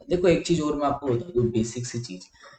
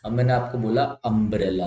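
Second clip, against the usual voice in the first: usual speaking voice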